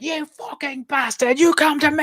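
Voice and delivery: high, hammed-up angry outburst; mock furious British merc. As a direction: bark it out like a cartoonishly furious mercenary. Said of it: "ridiculously hard ass he is at all moments".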